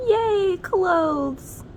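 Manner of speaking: high, loud squeal of joy; excited cheer. Acted. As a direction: squeal with delight over the clothes. Crayons excited by new clothes.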